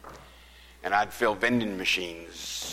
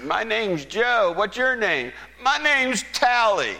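calling out; 14 semitones higher, 8 dB louder than usual. Retold or imitated. Shouted names across the lot.

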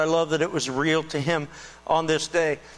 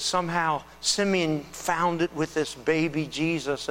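ordinary delivery

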